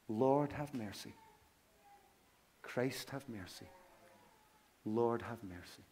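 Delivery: measured recitation, falling at each plea; liturgical prayer. Shown as a demonstration. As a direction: recite a simple ancient prayer with pauses.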